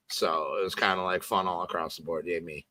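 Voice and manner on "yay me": ordinary delivery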